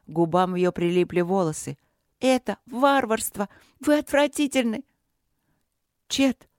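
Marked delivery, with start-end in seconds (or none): 2.2-4.8 put-on high-pitched, ringing accusation from a young princess; indignant outcry. A narrator's reading. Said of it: "крикнула она Чету так звонко". 6.1-6.5 high, surprised call to her husband. A narrator's reading. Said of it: "Света удивлённо повернулась к мужу".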